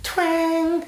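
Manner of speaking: twang stretched high, twangy: "twang"; country twang. Shown as a demonstration. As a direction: sing "twang" with a nasal country twang. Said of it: "give it that country twang".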